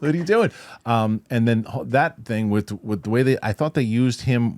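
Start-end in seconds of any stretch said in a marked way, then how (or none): 0.0-0.5 high, loud mock yell; imitated screaming. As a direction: act out a frantic shouted protest. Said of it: "He's just screaming".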